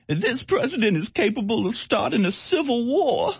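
mock her in a high put-on voice; mocking imitation. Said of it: "Maxine Waters, uh, saying".

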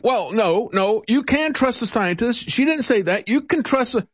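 high-pitched mock protest; Biden. Host mimicking candidate denying distrust of scientists, high-pitched protest. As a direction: retell a flustered denial, pitched far up.

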